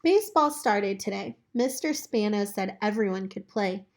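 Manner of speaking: plain reading voice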